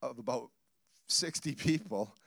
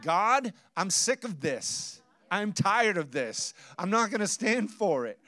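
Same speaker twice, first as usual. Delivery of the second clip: higher, louder, slow fed-up declaration; indignation; 5 semitones higher, 4 dB louder than usual. Retold or imitated. Pastor voicing his inner indignation to the congregation, fed up. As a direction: voice building fed-up outrage. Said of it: "this indignation inside of me".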